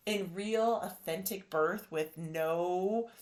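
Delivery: ordinary talk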